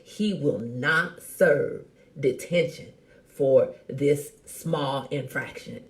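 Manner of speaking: very slow, word-by-word insistence; firm, deliberate. Retold or imitated. Parent's note refusing son's detention, slow and firm. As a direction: spell it out slowly and firmly. Reads out stern note to school.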